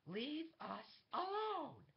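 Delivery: very high, slow, drawn-out scream; furious command. Acted. She repeats her order to the guard, furious screamed command. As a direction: scream it out, stretching each word.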